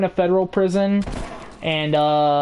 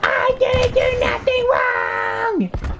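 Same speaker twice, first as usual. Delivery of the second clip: extremely high, drawn-out wailing protest; mock panicked shriek; slower than usual. Mother told she's going to jail, mock panicked shriek. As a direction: screech in hammed-up hysterical panic.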